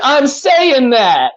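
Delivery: high, loud, very drawn-out whine; retold frustrated student, stretching every word; mock-exasperated insistence, pitch way up; exasperated protest. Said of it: "And I was like".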